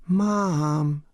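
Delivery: "Mom" stretched into two falling syllables, high; two-syllable descending call. Acted. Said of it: "Two syllables, descending".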